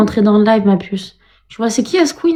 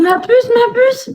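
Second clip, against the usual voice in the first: much higher, slow squealing pet name; squeaky high ma puce; squealed pet name; 12 semitones higher, slower than usual. Retold or imitated. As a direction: squeal it in mock affection. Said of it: "C'est à cause de Steph que je parle comme ça".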